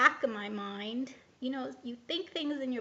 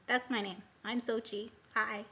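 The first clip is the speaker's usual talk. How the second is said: ordinary talk, nothing marked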